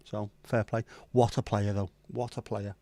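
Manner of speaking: ordinary talk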